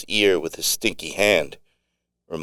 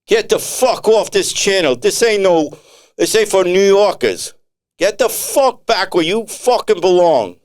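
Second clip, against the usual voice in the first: much higher, loud Tony ordering them off; furious yell; 12 semitones higher, 4 dB louder than usual. Acted. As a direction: drive the New Yorker off the channel in a rage. Tony yells New Yorker off channel.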